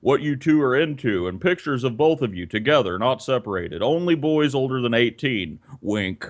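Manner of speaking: low, deadpan reading of a personal ad; mock ad reading. Host reads a creepy personal ad aloud, low deadpan. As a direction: read it out low and sleazy-deadpan.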